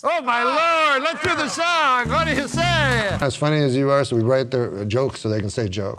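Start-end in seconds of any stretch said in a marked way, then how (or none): 0.0-3.2 high, slow, thrilled exclaiming; excited exclamation. Real, not acted. Host reacting to a joke, excited exclamation. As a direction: cheer it out.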